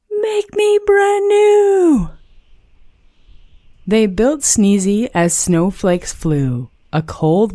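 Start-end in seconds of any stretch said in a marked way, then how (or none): 0.1-2.1 very high, slow, strange puddle voice; a wavering little voice rising from a puddle. A narrator's reading. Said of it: "A voice from the hot tub said".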